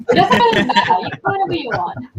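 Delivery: high-pitched, breaking into laughter; laughing. Real, not acted. Laughing off the pronunciation debate.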